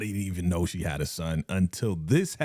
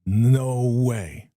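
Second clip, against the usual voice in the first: realising; 5 dB louder than usual. Real, not acted. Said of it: "Something just popped in my head".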